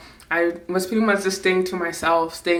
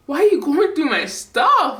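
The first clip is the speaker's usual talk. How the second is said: higher, indignant demand; feeling invaded; 6 semitones higher than usual. Retold or imitated. Daughter protesting an invasion of privacy, indignant. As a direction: demand an answer, pitch swooping up. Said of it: "felt like a huge invasion of privacy".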